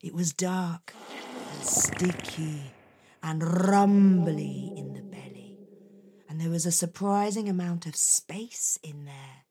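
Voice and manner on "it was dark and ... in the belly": ordinary delivery, nothing marked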